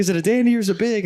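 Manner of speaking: fast, high, insistent retold question. Hunter pressing guide about the bear, insistent. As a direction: imitate an excited hunter demanding a straight answer.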